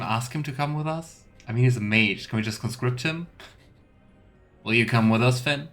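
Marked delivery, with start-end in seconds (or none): none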